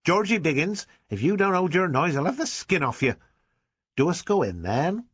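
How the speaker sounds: put-on a rough rural mum threatening her boy; scolding village mother. A narrator's reading.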